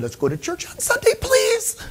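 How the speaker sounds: high, pleading plea to his wife with a laugh; asking his wife for church in a high wheedling voice; mock pleading. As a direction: beg like a little kid, half joking. Begging his wife, retold.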